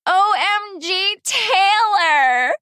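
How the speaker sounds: high, loud delighted shout; excited shout. Acted. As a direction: scream it like finally getting it. Guessing charades answer at last.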